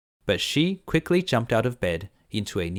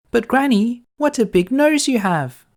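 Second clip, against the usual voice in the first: put-on much higher, bright-eyed little girl; 14 semitones higher, 9 dB louder than usual. Girl answering the wolf, high and innocent.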